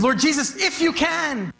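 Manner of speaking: father's plea fading to near silence; a father pleading, voice dying out; desperate father. Acted. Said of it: "the Father says".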